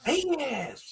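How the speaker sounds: put-on high child's answer; very high little girl voice; imitating daughter. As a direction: squeak it out like a small girl. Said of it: "she's like".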